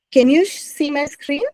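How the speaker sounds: usual speaking voice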